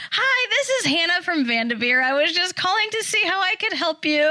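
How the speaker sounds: high, chirpy phone greeting; perky saleslady. Retold or imitated. Mom imitating the dealership caller, high and chirpy. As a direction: sound like a bright sales caller. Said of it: "She goes".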